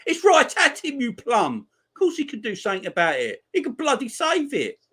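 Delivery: high-pitched, exasperated retort; indignant outburst. Real, not acted. Fan answering the commentator on the goalkeeper, indignant. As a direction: argue back in a high, outraged voice.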